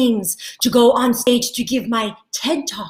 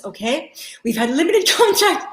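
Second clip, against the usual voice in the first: ordinary talk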